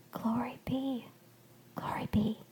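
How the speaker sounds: very soft, half-whispered awe; hushed, moved murmur; gruff murmur. A narrator's reading. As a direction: murmur it under the breath. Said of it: "Blue Shawl murmured gruffly".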